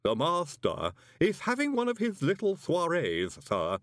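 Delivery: formal servant in a put-on voice; creepy butler, stately and polite; Igor manservant. A narrator's reading. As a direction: explain with unruffled deference. Igor servant stalls the visitor.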